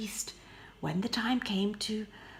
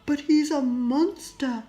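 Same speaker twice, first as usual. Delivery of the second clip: louder, higher scornful prince; 6 semitones higher, 10 dB louder than usual. Acted. Prince insisting to Beauty, loud scorn. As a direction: insist he's a monster.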